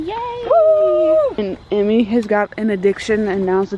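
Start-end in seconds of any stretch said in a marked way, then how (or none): 0.0-1.2 very high, loud whoop; squealing road-trip whoop; excited cheer. Real, not acted. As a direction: cheer like the trip just began. Leaving for Disney at 4am.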